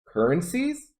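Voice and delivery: "Currencies" swept up high like a question; upward intonation. Shown as a demonstration. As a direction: lift the end of "Currencies". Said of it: "have upward intonation".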